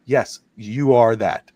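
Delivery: a slow patient answer to his son; slow reassurance. Retold or imitated. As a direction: spell it out slowly for the boy. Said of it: "I go".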